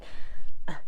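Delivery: loud breathy sigh; heavy weary sigh. Real, not acted. Tired of the long video.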